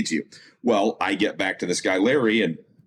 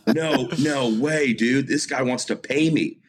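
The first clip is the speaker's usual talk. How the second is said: disbelieving excitement; 6 semitones higher, slower than usual. Retold or imitated. Stunned teen learns he'll be paid.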